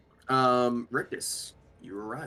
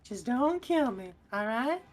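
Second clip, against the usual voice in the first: high, slow, wheedling goblin pleading for his life; begging goblin; slower than usual. Acted. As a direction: grovel on hands and knees as a goblin. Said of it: "He goes".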